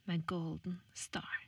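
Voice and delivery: breathy whispered brand name; whispered tagline. Shown as a demonstration. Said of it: "her kommer en stemmeprøve".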